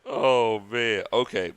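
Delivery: usual speaking voice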